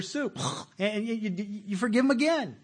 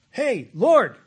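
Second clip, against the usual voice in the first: calling out; 7 semitones higher, 11 dB louder than usual. Acted. Preacher voicing Peter calling out.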